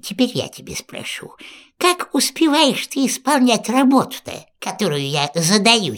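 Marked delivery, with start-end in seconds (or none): 0.0-1.3 hushed fast witch whisper. Acted. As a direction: lean in and whisper it. Yaga whispers ominously.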